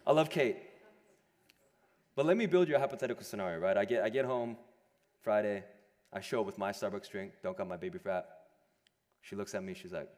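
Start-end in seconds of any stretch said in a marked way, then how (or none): none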